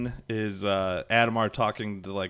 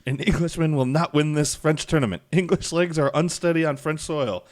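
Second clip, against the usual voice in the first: raised, loud, taunting quote; trash-talking Frenchman; 7 semitones higher, 6 dB louder than usual. Retold or imitated. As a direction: imitate a boastful trash-talker, pitched up and louder. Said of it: "all the French guys talking, uh, talking trash".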